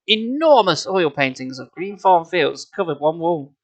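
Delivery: usual speaking voice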